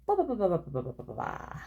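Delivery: starts high, tumbles down and fades away; rapid-fire sign-off. Real, not acted. Reader signing off to viewers, playful fading babble of byes. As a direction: rattle off goodbyes, dropping to a murmur.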